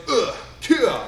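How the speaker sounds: short grunt, then a punchy kiai; kiai from the stomach. Shown as a demonstration. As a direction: push it from the belly, no screaming. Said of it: "from my stomach".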